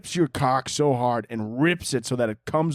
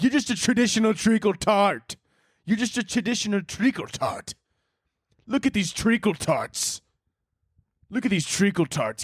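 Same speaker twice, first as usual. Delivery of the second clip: high-pitched, hammed-up insult; mock fight taunt; 6 semitones higher than usual. Someone about to fight hurling insults, mock taunting. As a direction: jeer like a cartoon brawler picking a fight. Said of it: "about to get in a fight and they're like".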